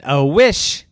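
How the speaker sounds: loud, indignant god; big loud voice. Acted. Zeus asked for a wish, big loud voice. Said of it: "the big, loud voice said".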